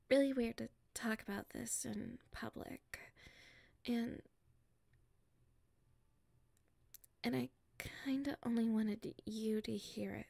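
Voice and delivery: hushed and secretive, keeping it private; nervous confiding whisper; shy whisper. Acted. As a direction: whisper so nobody around can hear.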